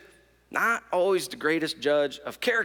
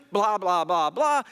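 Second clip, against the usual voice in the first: ordinary delivery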